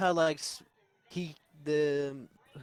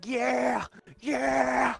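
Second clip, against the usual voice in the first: crowd cheering; 10 semitones higher than usual. Retold or imitated. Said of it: "everybody's saying".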